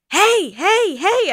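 high, loud repeated calls; sharp rising-and-falling shouts, retold; re-enacted yell. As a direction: yell to warn off the dogs. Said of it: "I'm yelling, you know".

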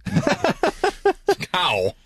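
slow, high, laughing exasperation; laughing mock curse. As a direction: drag out a half-laughing curse. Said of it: "I was like".

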